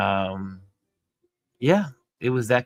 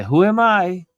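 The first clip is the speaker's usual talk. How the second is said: raised voice; 10 semitones higher, 6 dB louder than usual. Retold or imitated.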